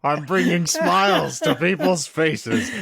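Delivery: hammed-up wrestler promo; wrestling promo voice. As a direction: growl it like a heel wrestler.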